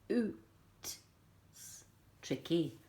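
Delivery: ordinary talk, nothing marked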